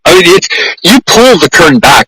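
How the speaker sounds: ordinary delivery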